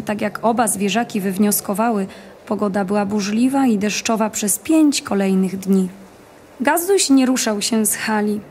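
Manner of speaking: usual speaking voice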